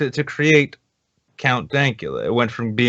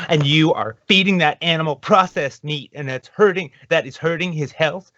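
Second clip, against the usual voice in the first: higher-pitched lightly voiced scold; scolding vegan; 6 semitones higher than usual. Vegan scolding Dankula over the cheeseburger, higher-pitched imitation. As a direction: lecture him as a concerned vegan. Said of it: "And she's like".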